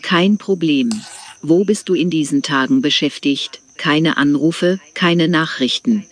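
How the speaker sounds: usual speaking voice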